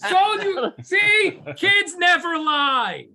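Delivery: high, slow mocking told-you. Real, not acted. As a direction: gloat in a high teasing voice. Mocking triumphant teasing.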